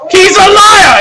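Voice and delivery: loud, high outburst; shouted accusation; judge shouting. Acted. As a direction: blurt it at the whole court. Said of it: "So the judge goes".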